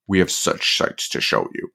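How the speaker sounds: low, whispered Pinhead line; Pinhead quote. Retold or imitated. To Kirsty, as Pinhead, creepy low whisper. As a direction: breathe it like a creepy cenobite.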